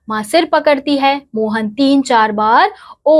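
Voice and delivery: ordinary delivery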